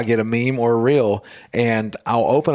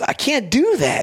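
high-pitched stand-up delivery, imitated; retold comedian; 12 semitones higher than usual. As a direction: retell it like a comedian on stage, pitched up. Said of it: "the man goes".

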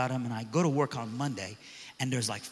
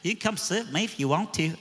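put-on higher, friendly kid voice; casual welcoming offer; friendly invite; 5 semitones higher than usual. Student inviting the mean kid to sit, lightly voiced friendly. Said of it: "and he's like".

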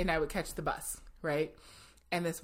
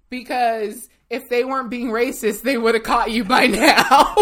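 loud, higher, breaking into big laughter; laughing punchline; 6 semitones higher, 15 dB louder than usual. Real, not acted. Storyteller tells the shoplifter why she gets away, laughing loudly. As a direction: belt it out while cracking up. Cracking up at her own punchline.

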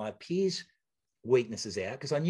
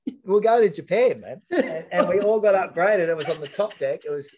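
laughing through it, higher and louder; 6 semitones higher, 9 dB louder than usual. Real, not acted. Athlete laughing to the host about the upgrade. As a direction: chuckle out the memory.

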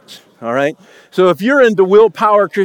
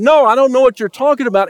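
quick, raised, defensive no; rushed denial; 6 semitones higher, 3 dB louder, faster than usual. Retold or imitated. Peter brushing off a second accuser, quick and raised.

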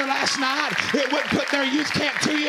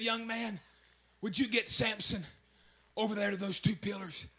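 much softer, a faint frail plea; weak Samson, hushed; 4 semitones lower, 11 dB quieter than usual. Acted. Weakened Samson asking the lad for help, hushed and frail. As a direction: ask for help with almost no strength left.

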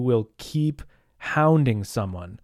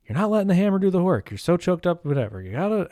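lecturing neighbor; 6 semitones higher than usual. Retold or imitated. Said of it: "And he goes".

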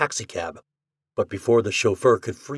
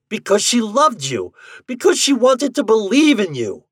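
high, loud, impassioned outburst; roar; 12 semitones higher, 7 dB louder than usual. A narrator's reading. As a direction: roar out the reason, pleading. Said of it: "he roared".

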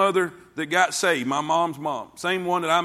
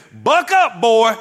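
higher and louder, a curt command; gruff aunt's order; 5 semitones higher, 9 dB louder, slower than usual. Retold or imitated. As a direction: snap it like a no-nonsense aunt. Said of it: "And my Aunt Jan says".

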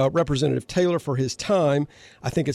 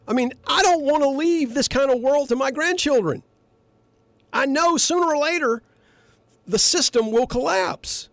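higher, earnest worry; still fired up; 10 semitones higher than usual. Real, not acted. Host to listeners about grandchildren, worked up. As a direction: plead for the grandchildren, heated. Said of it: "a little fired up [name]".

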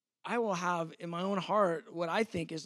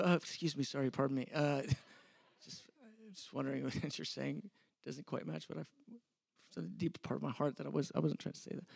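softer, laughing, mock-courteous apology; overly polite; 3 semitones lower, 6 dB quieter, faster than usual. Preacher showing his good-day reply, softer and hammed-up polite. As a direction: lay it on thick with politeness. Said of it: "Good day, I go".

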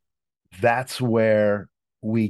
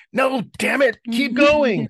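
high, loud exasperated plea; playfully pushing the client on; mock frustrated outburst; 4 dB louder than usual. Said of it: "sometimes I get mad".

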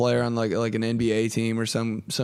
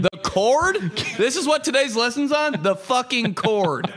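incredulous; 9 semitones higher than usual. Retold or imitated. Mocking the cord lesson.

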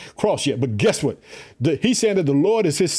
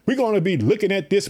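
ordinary talk, nothing marked

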